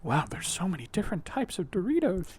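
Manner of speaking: hushed whisper of wonder. Pretend. Passenger marvels at the gas station snacks, whispering. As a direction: breathe it out in an awed whisper.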